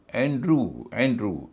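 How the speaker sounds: एंड्रू said as "an-droo"; no y in एंड्रू, end on pure oo; correct oo sound. Shown as a demonstration. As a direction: model एंड्रू clearly as "an-droo". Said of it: "इसका उच्चारण होगा".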